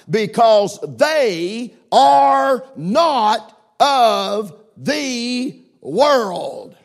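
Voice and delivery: slow, halting word-by-word reading; reading like a first grader. Shown as a demonstration. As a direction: read it slowly, one word at a time, for emphasis. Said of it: "I'm slowing down and reading like a first grader on purpose".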